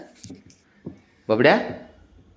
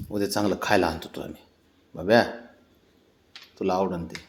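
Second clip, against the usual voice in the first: soft promise; 7 semitones lower, 9 dB quieter than usual. Real, not acted.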